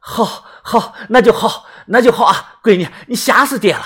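high, agitated relief; relieved father. Acted. Father hears his daughter is safe, high relieved voice. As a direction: let relief tumble out in repeats.

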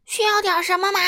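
high, loud hollered question; roaring grocer. A narrator's reading. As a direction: shout at the customer. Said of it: "杂货店老板吼道". (杂货店老板大声吼问)

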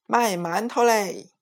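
high mock vendor call; boy mimicking vendor. A narrator's reading. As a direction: copy the vendor's cry. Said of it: "也大叫一声".

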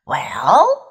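slow, low, rising "well". A narrator's reading. Horse pondering a question, very slow. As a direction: draw it out hesitantly. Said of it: "said Strawberry very slowly".

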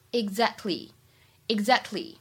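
"exactly" with the k barely released; slow demo of "exactly" with a half-lost k; incomplete plosion. Shown as a demonstration. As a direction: soften the k in exactly. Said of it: "不完全失去爆破".

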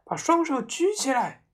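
high, loud shouted charge; girl charging the room with a knife; battle yell. A narrator's reading. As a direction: bellow it storming in. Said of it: "他哇哇叫着".

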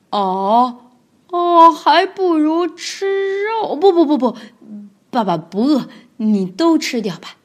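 慢吞吞带着干笑的大恐龙声音; 尴尬地推辞，故作大方; 为难的霸王龙. Acted. 霸王龙角色对白. (霸王龙尴尬干笑着推辞)

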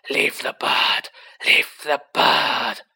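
loud, slow, drawn-out screamed order; strained yell, repeated with force; Riddle screaming. A narrator's reading. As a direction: scream the command, stretching every word. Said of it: "Harry heard Riddle screaming".

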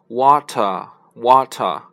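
water with a crisp British t; slow British water, clear t; teacher imitating British water; British accent. Shown as a demonstration. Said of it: "英国人是发".

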